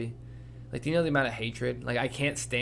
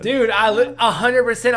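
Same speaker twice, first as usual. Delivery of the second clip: excited agreement; 10 semitones higher, 11 dB louder than usual. Real, not acted. Agreeing eagerly with friend.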